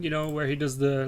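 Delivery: ordinary delivery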